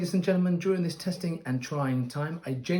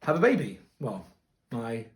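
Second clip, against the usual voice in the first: normal talking voice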